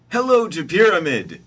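high, loud storybook hero voice; big, high-pitched cartoonish hello; Moses greeting.

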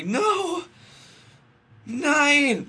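high, loud drawn-out shout; hammed-up wail of refusal; loud cry. A narrator's reading. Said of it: "she said loudly, almost in tears".

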